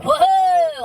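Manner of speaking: loud, high startled "Whoa!"; a big mock gasp of outrage; shocked whoa. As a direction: recoil at the extra fee. Told about a card fee.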